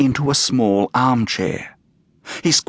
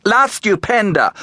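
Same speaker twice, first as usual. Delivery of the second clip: proclaimed high and grand; grand self-announcement; 11 semitones higher, 3 dB louder than usual. Acted. Fifi correcting the visitor with a grand stage name. She corrects her title.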